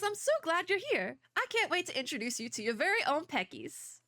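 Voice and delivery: bad Southern accent. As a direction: read it as a folksy Southerner, badly. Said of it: "the really bad Southern accent".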